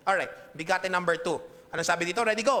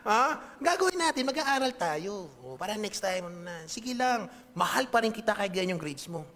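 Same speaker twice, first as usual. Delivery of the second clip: reassuring parent; 3 semitones higher than usual. Retold or imitated. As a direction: soothe your kid warmly, with a laugh at first. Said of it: "Sabi mo sa kanya".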